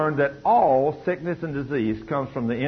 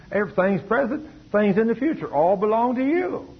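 fervent pastor; 7 semitones higher than usual. Retold or imitated. Pastor voiced preaching to Emily.

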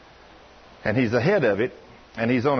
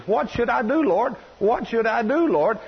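higher-pitched imitated plea to God; mildly urgent, repeated appeal; pleading prayer; 7 semitones higher than usual. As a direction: plead upward, as if retelling a desperate prayer.

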